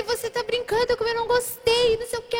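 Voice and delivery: high-pitched complaining outburst; retold upset rant. Upset girlfriend scolds boyfriend for the prank, desperate rant. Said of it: "Eu fiquei desesperada".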